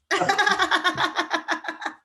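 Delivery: high rippling laugh. Real, not acted. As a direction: burst into a long, high giggle. Laughs at the couple.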